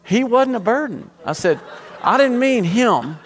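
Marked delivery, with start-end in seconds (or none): 0.0-1.1 high-pitched, offended widow; imitating an affronted widow; indignant retort. 2.0-3.2 high, loud, hammed-up backpedal; mock-panicked denial; flustered protest. Flustered young pastor's defence.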